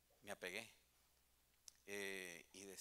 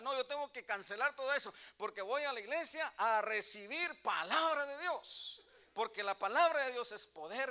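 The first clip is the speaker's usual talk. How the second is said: loud, fervent preaching to the congregation; rousing pulpit sermon voice; preaching at full force; 8 semitones higher, 10 dB louder than usual. Acted. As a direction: preach it out with passion, pushing every line. Preaching again after a quieter aside.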